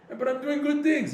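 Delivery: high, loud whiny protest; loud mock complaint; protesting self. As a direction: defend yourself indignantly. Acts person protesting to God.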